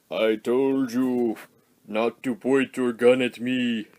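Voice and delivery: slow, measured giant robot voice; a huge robot scolding the gunman; giant robot warning. Retold or imitated. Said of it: "Metroplex was all like".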